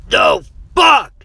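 very high, loud yell; shocked shout. A narrator's reading. Aaron reacts to Jeff's fall, shouting. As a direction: shout it out in alarm. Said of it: "Aaron shouted".

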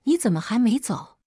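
angry demand. A narrator's reading. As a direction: snap it out in annoyance at her still being there. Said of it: "睁眼却对上凌烟气怒的脸".

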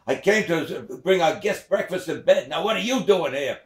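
high, loud huffy father; father explaining, then accusing; father's reply. Acted. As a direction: answer then throw it back. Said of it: "She says".

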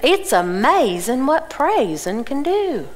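put-on slow, drawn-out Southern accent. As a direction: imitate an Atlanta lady's Southern accent, slowly. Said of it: "she really has a Southern accent".

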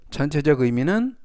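normal talking voice

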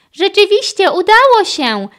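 high, loud astonished exclamation; loud, high-pitched amazement; amazed. A narrator's reading. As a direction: marvel at it, voice leaping up. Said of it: "powiedział zdumiony Carlos".

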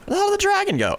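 startled, searching the sky; alarmed player looking for the dragon; surprised. Real, not acted. Loses sight of dragon.

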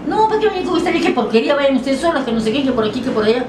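high-pitched scornful scolding; mock a man dismissing someone with insults, pitched high; acted-out insults. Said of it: "porque me insultó". (regaño despectivo imitado, en tono agudo)

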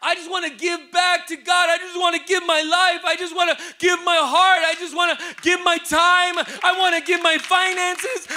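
a congregant's rising vow; voicing a believer's vow. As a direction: cry it out like someone giving everything. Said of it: "and you say".

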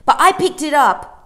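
put-on high, loud indignant boy; loud protest. A narrator's reading. Michael protests to Mary Poppins, loud and high. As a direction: protest loudly like a cross child.

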